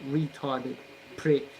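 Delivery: usual speaking voice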